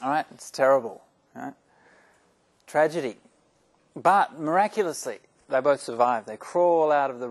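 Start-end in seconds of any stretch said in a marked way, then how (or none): none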